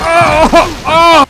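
high, loud "oh" yelps; two sharp yelps of surprise; startled cries. Real, not acted. Gamer caught off guard in game, yelping.

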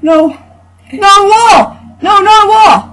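high shrieking panic; terrified yelling. Real, not acted. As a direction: shriek in dismay. Hitting a wall while fleeing.

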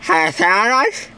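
talking through a mouth guard; slurred words around a mouth guard. Real, not acted. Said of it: "with this mouth guard in my mouth".

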